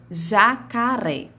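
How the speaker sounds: "jacaré" slowly, syllables drawn out. Shown as a demonstration. Teacher giving an example of syllables, slow and measured. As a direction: stretch out each syllable of "jacaré". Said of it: "elas são formadas por sílabas".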